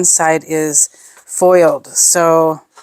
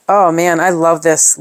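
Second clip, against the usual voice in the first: normal talking voice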